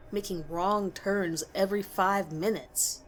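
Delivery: plain reading voice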